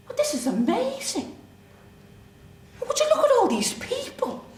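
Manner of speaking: high-pitched, wide-eyed wonder; amazed. Retold or imitated. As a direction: marvel at a huge crowd, pitch leaping up. Acted amazement at the crowd.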